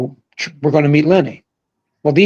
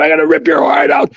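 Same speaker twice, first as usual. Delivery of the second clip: mock scream. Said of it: "He's screaming at him".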